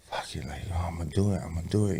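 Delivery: breathy whisper, almost to himself; whispered inner thought. Retold or imitated. Whispering to himself at church before getting baptized. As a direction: whisper it like you're talking yourself into it.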